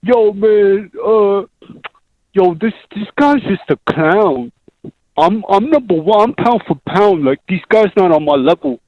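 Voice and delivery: impression that comes out like a dim male model; Zoolander. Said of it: "that one sounds a little bit more like Zoolander".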